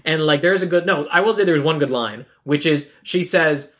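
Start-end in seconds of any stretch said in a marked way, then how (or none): none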